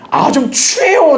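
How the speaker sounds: high, drawn-out mock disdain; disgusted put-down. Friend belittling the person preaching to him, drawn-out disdain. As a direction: stretch the insult out in a high complaint.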